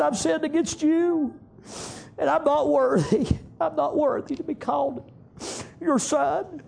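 a sinner's high broken plea; pleading son. Acted. Son's confession, softer.

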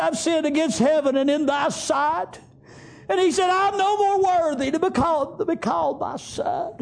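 a sinner's high broken plea; pleading son. Acted. Son's confession voiced.

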